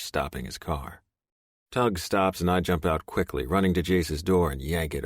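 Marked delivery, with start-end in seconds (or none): none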